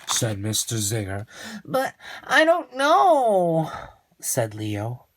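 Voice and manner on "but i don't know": put-on puzzled boy.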